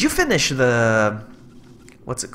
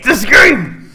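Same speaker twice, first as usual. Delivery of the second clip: loud, higher burst of frustration; exasperated yell; 8 semitones higher, 10 dB louder than usual. Real, not acted. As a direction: snap loudly at the game. Exasperated with the game.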